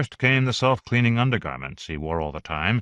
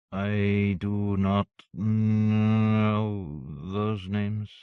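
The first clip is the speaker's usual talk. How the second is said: very slow, croaking machine voice; harsh robot croak; much slower than usual. Acted. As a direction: grind out each word like an old robot. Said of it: "a harsh croak".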